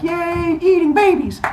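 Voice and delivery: high mock crowd cheer; drawn-out, high-pitched yay. Titans cheering Cronus's side, high mock cheer.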